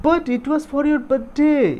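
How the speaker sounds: put-on high little girl voice, pleading; a small child begging her father to understand; child's plea. Little girl pleads with her angry father, high and pleading.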